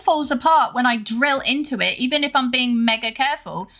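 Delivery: indignant rant. Real, not acted. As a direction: protest hotly that you are blameless. Defensive rant about the broken wall.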